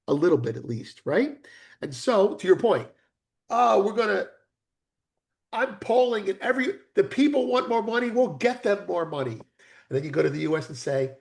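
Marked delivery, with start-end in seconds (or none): 3.5-9.3 higher eager politician voice. Retold or imitated. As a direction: play a politician promising money to voters. Voicing a populist politician.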